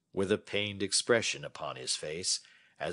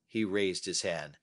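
plain reading voice

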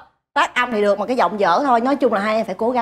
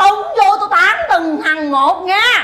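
raised, slow scolding threat; angry threat; 6 semitones higher, 3 dB louder, much slower than usual. Acted. Manager threatens two bickering singers, angry and loud. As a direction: lay down the law, loud and drawn out.

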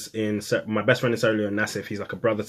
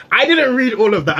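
high, loud burst of excitement; squealing with disbelief; excited squeal; 12 semitones higher, 8 dB louder than usual. Real, not acted. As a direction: blurt it out thrilled. Excited recounting of winning email.

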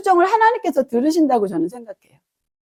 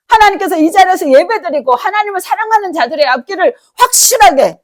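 raised, higher preaching voice; fervent preaching; 6 semitones higher, 4 dB louder than usual. Acted. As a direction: proclaim it with full conviction. Preaching at full force. (높은 목소리로 힘차게 선포하듯)

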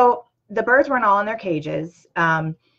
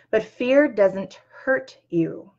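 slow, deliberate, every word weighed; very slow, firm reassurance; slow emphatic; 3 semitones higher, much slower than usual. Real, not acted. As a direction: lay it down slowly so it sinks in. Slow emphatic repeated message.

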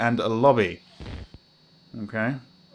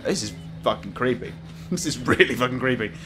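ordinary delivery

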